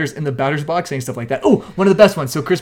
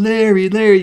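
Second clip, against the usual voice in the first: louder, higher taunting name call; mock heckling; 5 semitones higher, 6 dB louder than usual. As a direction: jeer the name like a fan. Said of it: "would up there be going".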